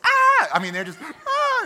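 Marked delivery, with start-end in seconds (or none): 0.0-0.5 very high, loud shriek; hammed-up terrified shriek; schoolgirl scream. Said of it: "Little schoolgirls". 1.0-1.6 high, loud mock-terrified shriek; hammed-up scream of fright. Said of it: "they shrieked or they screamed".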